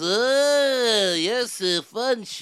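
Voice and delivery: high, loud, drawn-out wail; wildly exaggerated singer's whine, vowels held. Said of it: "this is [name]".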